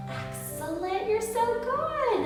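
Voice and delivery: high, slow, delighted praise; gushing praise. Acted. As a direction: gush over the child. Praising child dancer.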